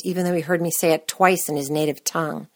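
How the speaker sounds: plain reading voice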